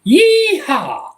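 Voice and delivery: high, hammed-up cowboy whoop; joking cowboy yell for chat instead of the requested accent; cowboy yeehaw. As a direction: whoop it like a rodeo hand, tongue in cheek. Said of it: "you're gonna get it from a Michigan accent".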